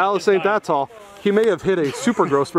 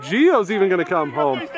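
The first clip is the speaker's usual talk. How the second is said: usual speaking voice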